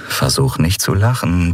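ordinary delivery, nothing marked

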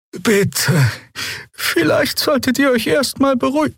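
very high, small voice; pleading peacemaker. A narrator's reading. Healer Xea calming the quarrellers, pleading in high voice. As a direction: plead in a high-pitched character voice.

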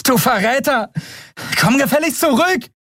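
high, indignant yell; calling after someone. Acted. Endriel to fleeing Keru, angrily calling him back. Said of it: "rief Endriel ihm nach".

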